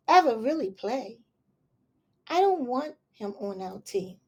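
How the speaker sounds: put-on gentle read-aloud to children; story reading. Reading a picture book aloud to children, gentle storytelling.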